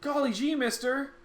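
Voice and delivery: put-on high, eager old-timey kid voice; wholesome 1950s kid. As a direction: gush like a fifties kid. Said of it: "We're gonna go back to the sixties".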